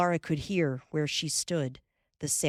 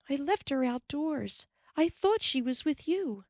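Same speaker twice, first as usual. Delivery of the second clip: worried; 10 semitones higher than usual. A narrator's reading. Ma worried, voiced in story.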